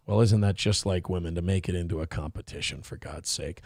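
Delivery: low, quiet mock grumble; mock sexist voice. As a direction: sneer it like a chauvinist. Mocking sexist remark, deep voice.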